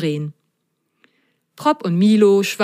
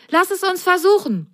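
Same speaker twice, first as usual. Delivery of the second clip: eager excitement; 12 semitones higher than usual. Acted. Milo jumps about excitedly.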